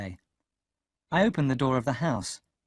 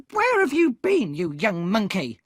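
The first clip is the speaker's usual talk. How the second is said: much higher, louder shrill scold; angry shout; 9 semitones higher, 6 dB louder than usual. A narrator's reading. Sister scolding Pip for coming home late, shouting. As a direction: yell at the boy furiously. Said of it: "Missus Joe cried".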